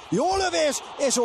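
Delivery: high excited cry; excited call. Real, not acted. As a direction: spike up on the shot. Shot called in live commentary.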